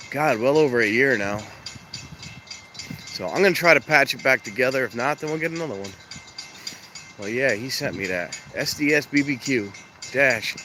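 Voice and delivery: normal talking voice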